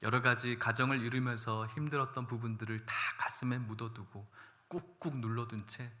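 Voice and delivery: ordinary talk, nothing marked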